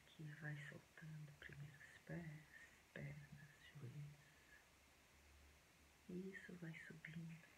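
soft, hushed meditation voice with long pauses; quiet, unhurried relaxation guidance; guided meditation. Acted.

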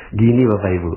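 normal talking voice

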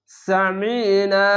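Samina slowly, last vowel held long; drawn-out recitation. Shown as a demonstration. Teacher modelling the one-alif stretch, slow and drawn out. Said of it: "এক আলিফ টেনে পড়বেন".

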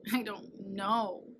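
slow, drawn-out moan. Acted. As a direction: drag it out in a slow sad moan. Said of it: "moaned Kansas".

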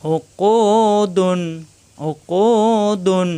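slow Quranic chant of উকুদুন; slow drawn-out recitation. Shown as a demonstration. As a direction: stretch each syllable of উকুদুন. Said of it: "দাল দুই পেশ ধুন".

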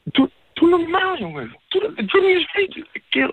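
high, strained yelling; he can barely contain himself; telling his friend to stop talking, furious and high. Real, not acted. Furious at friend over sister.

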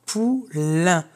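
POULAIN split into two parts, pou, then lain; syllable by syllable. Shown as a demonstration. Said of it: "dans la deuxième syllabe".

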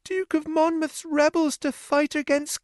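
high, tearful woman's voice; a sister crying her news; Annie weeping. A narrator's reading. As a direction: sister in tears telling her brother.

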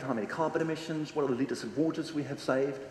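usual speaking voice

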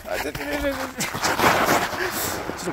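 high, startled cries of wait wait; panicked high-pitched wait; alarmed squeals. Real, not acted. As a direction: yelp as the feet start sliding. Slipping on steep sand slope.

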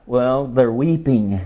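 normal talking voice

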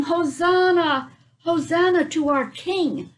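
drawn-out, high, loud chant of praise; cheering crowd. Acted. Crowd hailing Jesus on Palm Sunday, slow joyful chant. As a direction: call it out like a joyful crowd at a parade.

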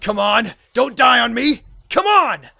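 pleading that climbs into a loud shout; desperate urging. Acted. Soldier to dying comrade, desperate shouted plea.

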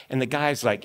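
ordinary delivery

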